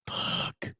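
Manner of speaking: breathless whisper of the bad word; whispered confession. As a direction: barely let it out, ashamed. Kid forced to say word.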